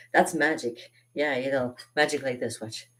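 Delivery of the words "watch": ordinary delivery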